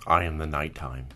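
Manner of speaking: low, quiet brooding hero voice; Batman voice. Pretend. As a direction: growl it like a dark vigilante. Mock Batman gravel voice.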